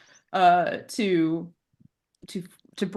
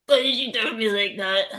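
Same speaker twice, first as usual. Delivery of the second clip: teddy bear voice. Acted. GM repeats teddy bear line.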